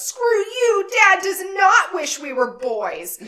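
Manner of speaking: high, loud bratty shout at dad; angry kid yelling. Retold or imitated. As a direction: yell it like a furious kid at the table. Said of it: "Stevie goes".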